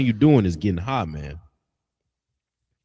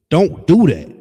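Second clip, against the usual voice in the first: sharp, louder warning; loud rebuke; 4 semitones higher, 8 dB louder than usual. Real, not acted. Preacher scolding the congregation, loud sharp warning. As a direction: bark it at the smoker.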